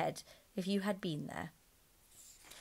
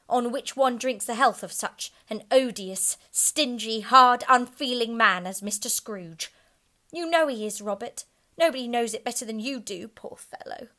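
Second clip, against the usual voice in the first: higher, louder indignant wife; Missus Cratchit angry; 5 semitones higher, 9 dB louder than usual. A narrator's reading. The wife scolding about Scrooge, loud and indignant. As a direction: spit out her scorn for Scrooge. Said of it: "cried Missus Cratchit".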